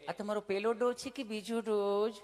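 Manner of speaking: gentle mock nurse. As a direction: play the polite nurse asking. Comedian voices nurse asking.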